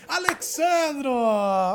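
loud drawn-out call of a name. Real, not acted. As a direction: hail him across the room. Host hails guest loudly.

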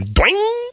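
very high cartoon boing noise; springy boing. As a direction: imitate a spring bouncing high. Imitating a springy jump.

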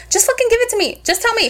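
high, fed-up demand; high exasperated voice. Retold or imitated. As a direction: plead for it, pitched high. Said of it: "I'm like".

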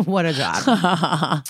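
laughing through it, slowly; slow laughing repeat. Real, not acted. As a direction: chuckle the words out. Laughing repeat of own remark.

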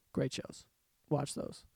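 a soft whispered aside; hushed. Real, not acted. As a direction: drop to a whisper for the echo. Repeated recommendation, second hushed.

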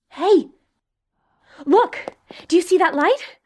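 excited. Acted. Spots a light.

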